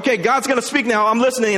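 high, loud, hurried readiness; eager anticipation. Acted. Elijah expects God to speak from the fire, eager. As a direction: jump in, sure it's coming.

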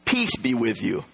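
soft, slow, gentle greeting; calm them softly, word by word; gentle peace. Acted. Jesus calming his frightened disciples, soft and slow. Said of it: "and he says".

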